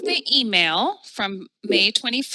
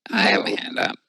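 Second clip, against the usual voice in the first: low growly rasp; rough gravelly-sounding voice; 3 dB louder than usual. Real, not acted. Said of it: "[name]'s growly voice".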